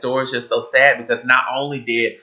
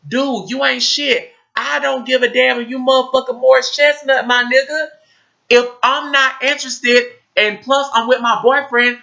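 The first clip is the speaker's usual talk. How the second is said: put-on fed-up young woman; 12 semitones higher than usual. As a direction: tell him off sharply as the girl. Voicing the girl, much higher.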